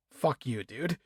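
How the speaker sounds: scornful aside at a cheating character; muttered dismissal. Real, not acted. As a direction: toss it off under the breath.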